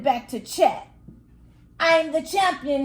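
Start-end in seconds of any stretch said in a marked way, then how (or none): 1.8-2.8 bragging. A narrator's reading. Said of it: "he bragged".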